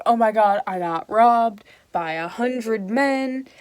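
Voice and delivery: loud, slow, hammed-up alarm; frantic outcry. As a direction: burst in overdramatically. Falstaff bursting in, robbed.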